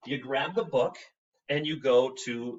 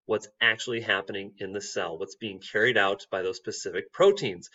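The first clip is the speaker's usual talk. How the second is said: ordinary delivery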